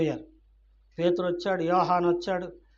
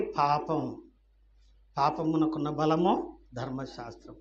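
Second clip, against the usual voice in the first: normal talking voice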